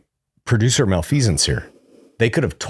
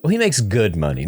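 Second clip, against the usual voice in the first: ordinary delivery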